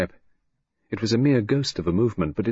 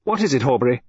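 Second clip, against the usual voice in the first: sharp; 4 semitones higher, 4 dB louder, faster than usual. A narrator's reading. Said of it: "Lydia said sharply".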